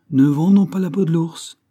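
high, loud retort. Acted. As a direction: fire the proverb back. Engineer retorting with a proverb.

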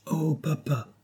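put-on high but soft childlike cry; child's exclamation. A narrator's reading. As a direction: call out to papa, high-pitched. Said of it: "s'écria Jenny".

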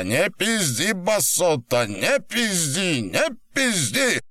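high, drawn-out insistence; angry rebuke. Acted. Captor rejects victim's story, angry high repeated rebuke. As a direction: hammer each repeat, high and stretched.

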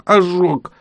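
high, moaned single word; high cry of pain. A narrator's reading. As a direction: groan it out in pain. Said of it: "простонала Роксана".

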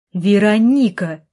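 loud, drawn-out shout of a name; roared call. A narrator's reading. Brother roars after sister who walks away, loud. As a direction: bellow the name after her, slowly. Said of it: "рявкнул снова".

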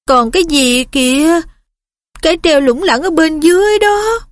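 trembling, high frightened voice; shaky voice. Acted. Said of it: "Ron hỏi bằng giọng run run".

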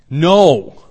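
sudden shouted no, pitch jumping up; emphatic loud no. Real, not acted. Preacher to the congregation, a loud emphatic no. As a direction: slam the door on that idea with a loud no. Preacher rejects staying away.

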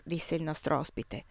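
plain reading voice

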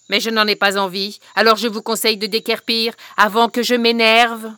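loud, fast threat; loud menacing hag snarl; threatening witch. Acted. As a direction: scare them off before she loses her temper.

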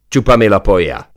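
loud swearing war cry; full-throated shout. Acted. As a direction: bellow it over the gunfire. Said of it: "üvöltötte ő is tele torokból".

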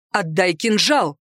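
slow, measured order on отдай кинжал; stern, restrained male-character command. A narrator's reading. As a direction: give the order deliberately, кинжал falling. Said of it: "приказал он".

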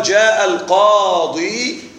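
القاضي drawn out with its long final ya; very slow example. Shown as a demonstration. Teacher giving the example with al to students, drawn out slowly. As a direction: lay out the example slowly for the class. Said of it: "فيقال".

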